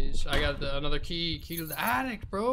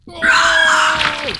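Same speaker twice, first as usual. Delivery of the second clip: scared scream; 13 semitones higher, 17 dB louder than usual. Real, not acted. Jump scare.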